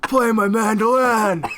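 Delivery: slow, loud, laughing mock tough guy; The Rock impression. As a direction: drag it out like a hammed-up action star. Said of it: "I was doing The Rock".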